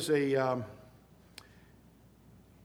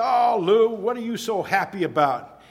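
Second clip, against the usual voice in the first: excited greeting; 5 semitones higher, 8 dB louder than usual. Retold or imitated. Retold greeting to cheerful friend.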